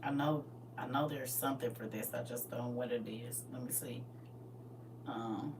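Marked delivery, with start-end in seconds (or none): 1.9-5.5 soft whisper while searching; whispering while looking around; whispered to herself. Real, not acted. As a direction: breathe it to yourself, distracted. Searching for something, muttering to self.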